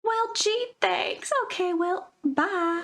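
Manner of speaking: lightly voiced friend ducking out politely; mock fake thanks. Friend ends the chat.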